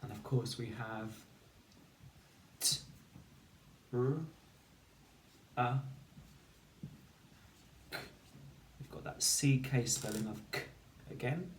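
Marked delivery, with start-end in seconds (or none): 2.6-8.0 each sound of truck in isolation. Shown as a demonstration. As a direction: say t, r, a, k with long gaps. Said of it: "You need to say the sounds".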